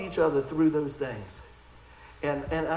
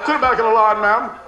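much louder, bossy mock command; mock bossy order; 3 semitones higher, 9 dB louder than usual. Pretend line-cutter ordering an old lady back, loud and bossy. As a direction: bark it like a pushy line-cutter, hammed-up.

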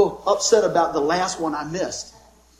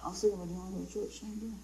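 fast, much softer mumble with lips barely moving; mumbled invite; 2 semitones lower, 17 dB quieter, faster than usual. Retold or imitated. Churchgoer nervously asking again about church, mumbled. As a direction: rush it out under your breath. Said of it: "You don't even use your lips".

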